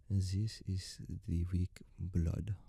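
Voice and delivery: put-on slow, drawn-out German accent. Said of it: "stereotypical German accent".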